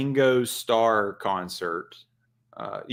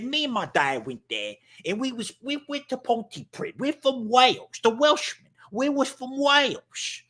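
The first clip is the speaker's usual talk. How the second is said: high-pitched comic Welshman; Welsh accent; 12 semitones higher than usual. Acted. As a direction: tell it as an indignant, sing-song Welsh fella. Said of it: "What about the Welsh?".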